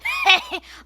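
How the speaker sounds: big burst of laughter; loud laugh. Acted. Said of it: "Sid started to laugh".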